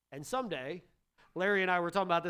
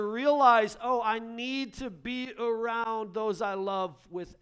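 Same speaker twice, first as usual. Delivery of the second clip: loud, high preaching that keeps building; full-force preaching; 6 semitones higher, 8 dB louder than usual. Acted. Pastor urging parents to value their families, loud fervent preaching. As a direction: preach it hard, like a revelation.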